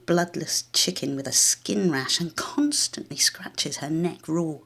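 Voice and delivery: plain reading voice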